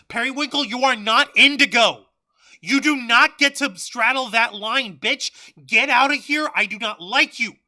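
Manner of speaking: over-the-top joke tirade, loud; mock furious rant. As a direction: bawl the colour out like a rival. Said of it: "I'm fucking trying to do a joke".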